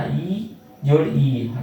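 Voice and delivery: slow, ई जोड़ ई said deliberately, each ई drawn out; slow vowel demo. Shown as a demonstration. Teacher showing students how two vowels combine, slowly. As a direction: lay out the sounds one by one. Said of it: "दीर्घई भी क्या चीज़ से बना?".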